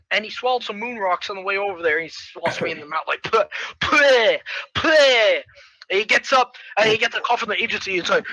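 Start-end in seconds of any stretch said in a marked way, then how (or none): 3.2-5.4 repeated explosive "puh"; spitting noises. Acted. Hero spitting moon rocks, mock spluttering. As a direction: spit out a mouthful.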